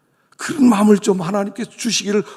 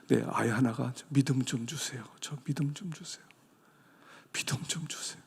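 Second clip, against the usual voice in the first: soft quoted plea; 4 semitones lower, 10 dB quieter than usual. Retold or imitated. Students' prayer requests voiced.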